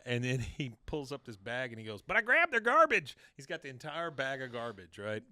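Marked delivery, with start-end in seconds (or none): 2.0-3.2 high, loud, hammed-up boast; triumphant shout. Boy revealing his bag of garbage, triumphant shout. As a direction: blurt it out loudly, way up in pitch.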